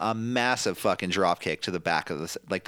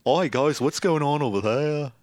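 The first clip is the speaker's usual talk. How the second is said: put-on Liverpool drummer accent; playful Liverpudlian imitation; 4 semitones higher than usual. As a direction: interrupt the bandmates in mock Scouse. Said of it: "All right, [name]".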